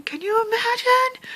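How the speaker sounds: high thrilled rise; excited squeal. Real, not acted. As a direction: squeal it with joy. Repeated in excitement.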